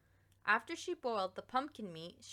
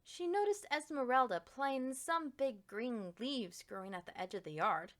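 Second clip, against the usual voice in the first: ordinary delivery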